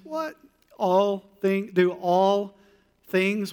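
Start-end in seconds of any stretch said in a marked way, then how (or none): 0.0-0.3 very high, breathy "what?" of fake shock; mock disbelief. Preacher pretending shock at "all things", mock disbelief. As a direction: act stunned by the words.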